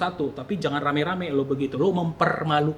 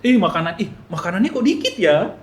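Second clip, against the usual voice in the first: blurted out; 3 semitones higher, 6 dB louder than usual. Acted. Hungry guest seeing too little food, blurting a complaint. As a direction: blurt it out without thinking. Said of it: "kita bisa nyeplos dong kayak gitu".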